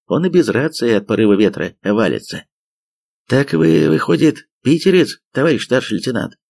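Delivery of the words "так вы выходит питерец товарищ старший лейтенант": curious. A narrator's reading. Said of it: "с любопытством спросил Торчок".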